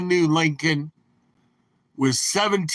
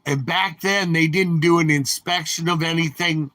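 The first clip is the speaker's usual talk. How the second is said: ordinary talk, nothing marked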